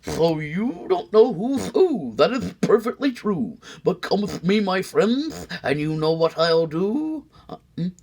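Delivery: wink through the pitch; sly salesman. Acted. McBean luring the sneetches back, high sly salesman voice. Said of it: "he with a sly wink".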